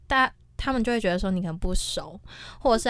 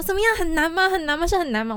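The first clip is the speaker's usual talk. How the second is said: worried mom; 7 semitones higher than usual. Retold or imitated. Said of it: "我妈就跟我讲说".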